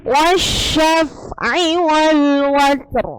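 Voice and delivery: very slow "Wasyaf'i wal watr", heavy r; slow recitation. Shown as a demonstration. Teacher reciting a Quran verse to the class, very slow. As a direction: draw out each syllable of "Wasyaf'i". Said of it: "roknya dibaca tebal".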